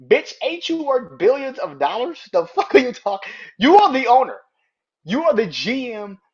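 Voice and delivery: high, loud indignant tirade; outraged scolding. Pretend. Fan berating the owner who is also GM, outraged. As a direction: shout it at the boss who should know better.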